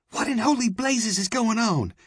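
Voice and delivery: put-on startled girl, very high voice; way too loud. A narrator's reading. Girl sees two gunmen in hall, loudly startled. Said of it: "she said, way too loudly".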